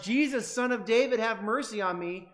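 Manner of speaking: a beggar calling loudly after a passing teacher; calling out for mercy. Retold or imitated. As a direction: cry out to be heard over a crowd.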